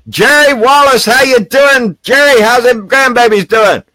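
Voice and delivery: high, loud hearty greeting; cheerful call-out. Real, not acted. Greeting a viewer in the chat, a loud cheerful call-out. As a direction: sing out hello across the room.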